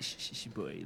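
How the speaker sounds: put-on soft, low shush to hush a boy. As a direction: shush him quietly under your breath. Mom hushing him at dinner.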